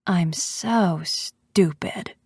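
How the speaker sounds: slow, deflated admission; halting, drawn-out and crushed; laughter breaking down. A narrator's reading. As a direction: let the hysteria collapse into slow, broken words. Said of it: "causes the laughter to break".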